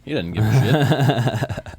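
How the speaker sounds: slow, laughing through it. Real, not acted. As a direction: laugh while saying it.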